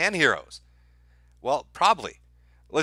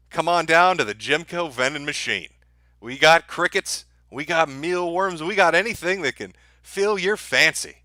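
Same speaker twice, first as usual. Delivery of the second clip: mock ad pitch; 3 semitones higher than usual. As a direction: sell the bugs like a cheesy commercial. Mock vending machine ad.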